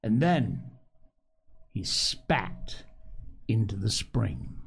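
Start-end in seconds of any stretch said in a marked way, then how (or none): none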